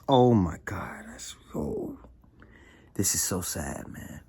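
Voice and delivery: fading hushed dismay; real, whispered sorrow; sad whisper. Real, not acted. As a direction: whisper your grief. Reacting to babies harmed.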